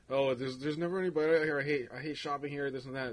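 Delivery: put-on complaining voice; complaining customer. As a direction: imitate a customer moaning on and on. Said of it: "When customers complain to me".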